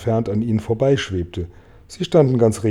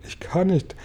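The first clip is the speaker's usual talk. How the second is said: higher, softer whimper; whining; 7 semitones higher, 4 dB quieter than usual. A narrator's reading. Glow-worm with a broken bulb, soft whining. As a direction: whine it helplessly. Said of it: "jammerte Glühwürmchen".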